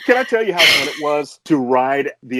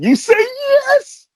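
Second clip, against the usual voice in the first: yes drawn out, much higher and slow, voice breaking; emphatic yes; 5 dB louder, much slower than usual. Real, not acted. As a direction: plead it with full feeling. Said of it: "I'll say to you again".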